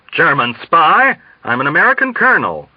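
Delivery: high outraged disbelief; protest, outraged; indignant disbelief. Acted.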